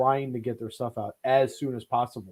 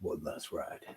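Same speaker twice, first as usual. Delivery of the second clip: very low, hushed movie-trailer growl; deep voice; 13 semitones lower, 16 dB quieter, slower than usual. Acted. Host adds a film catchphrase, deep hushed voice. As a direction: drop it right down to a near whisper. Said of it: "say it in a deep voice".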